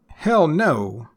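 "hell" thrown up high, then "no" falling; stress on hell. Shown as a demonstration. Refusing to vote for someone, high stress on "hell". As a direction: push "hell" up and let "no" drop. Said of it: "emphasis on that first word".

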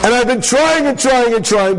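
acted fed-up outburst; exasperated complaint. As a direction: hammer out the repeated effort in exasperation. Woman's exasperated complaint to rabbi.

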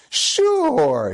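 high, stretched-out cheeky agreement; breezy mock obliging; wise guy. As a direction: draw it out like a smart aleck. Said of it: "being a little bit of a wise guy".

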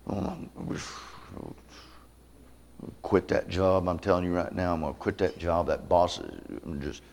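stewing mutter. Retold or imitated. Man driving home muttering about his job. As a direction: mutter angrily to yourself.

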